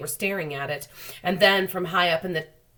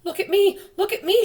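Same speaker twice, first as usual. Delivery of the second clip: much higher, loud gleeful shout; excited shout; 7 dB louder than usual. Acted. The freed centipede to the others, excited high shouting. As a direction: shout it out with wild glee. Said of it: "shouted the centipede excitedly".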